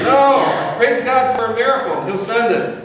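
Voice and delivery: high, loud insistent refusal; yelling back. Retold or imitated. Man at the window yelling back at the boat crew, high loud refusal. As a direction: stubbornly shout him off. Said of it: "he yells back".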